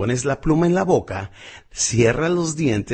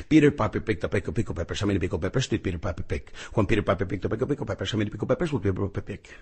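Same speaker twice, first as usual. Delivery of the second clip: tongue twister mumbled around a pen held in the teeth, softer; pen in mouth; 5 semitones lower, 5 dB quieter than usual. Shown as a demonstration. Speech coach showing the pen drill, muffled by the pen. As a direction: talk with a pen clamped between the molars. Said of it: "Me pongo mi pluma en la boca".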